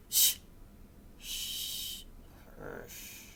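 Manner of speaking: voiceless, drawn-out shushes; fading hushes; hushing. Acted. Hushing in the story.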